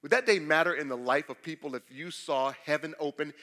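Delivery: normal talking voice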